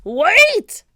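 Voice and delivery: high, loud cry; loud high captain cry; desperate cry. A narrator's reading. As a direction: call after the fleeing boat. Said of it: "cried Captain Bones".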